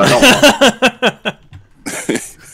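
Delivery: laughing reluctant refusal, high and loud. Real, not acted. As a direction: laugh through a half-hearted no. Refusing to do Southern accents.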